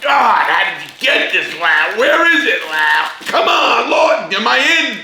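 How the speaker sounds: high, loud mock rave over the food; hammed-up amazed food lover; imitating an amazed inmate. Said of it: "gonna make somebody".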